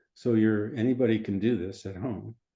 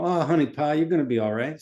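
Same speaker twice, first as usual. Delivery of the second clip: put-on playful American Southern accent, pitched up; 5 semitones higher, 4 dB louder than usual. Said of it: "Say it in Southern".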